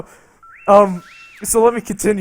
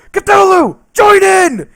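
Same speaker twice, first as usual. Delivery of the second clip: much higher, louder gleeful yell; excited shout; 12 semitones higher, 11 dB louder, slower than usual. Retold or imitated. Inviting Cthulhu into the shower scene, a gleeful loud shout. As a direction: cheer the monster on at full voice. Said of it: "I'm like".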